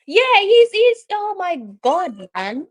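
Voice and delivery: high, loud exasperated outburst; high loud oh my God. Real, not acted. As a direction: burst out in exasperation. Exasperated at referee.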